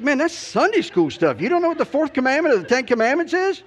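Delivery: chaplain rubbing it in a second time; mock incredulity. Chaplain teasing him again.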